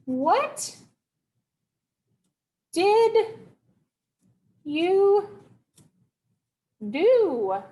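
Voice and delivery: high, spaced-out call, word by word; mock yelling. Mom yelling out to child in tree, mock yell. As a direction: imitate a mother yelling, one word at a time. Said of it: "she's yelling out to me".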